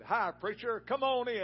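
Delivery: loud, high, booming welcome; hearty greeting. Retold or imitated. Farmer welcoming the preacher, loud and high. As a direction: throw the door open with a big hello.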